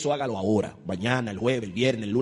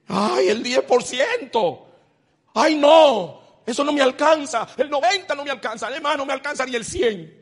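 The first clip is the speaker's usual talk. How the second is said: high, loud hammed-up complaint; mock whining churchgoer; 11 semitones higher, 8 dB louder, slower than usual. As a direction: whine like someone dodging the tithe. Mimicking a member complaining about tithe.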